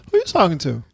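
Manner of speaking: ordinary talk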